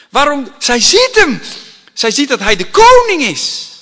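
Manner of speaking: high, loud preaching cry; impassioned proclamation. Real, not acted. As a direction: proclaim it at full force.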